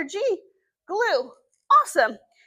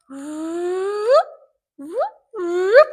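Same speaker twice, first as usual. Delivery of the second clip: playful whoop; 5 semitones higher, 4 dB louder, much slower than usual. Acted. Drawing letter in air.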